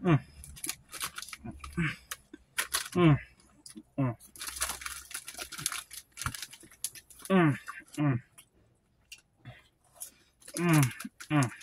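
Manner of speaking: humming while chewing; chewing with mouth full. Real, not acted. Seen 0: Puts orange puffs in mouth repeatedly, chewing while humming throughout.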